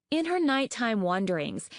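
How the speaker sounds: ordinary delivery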